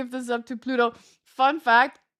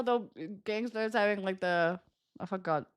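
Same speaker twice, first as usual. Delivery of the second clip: ordinary delivery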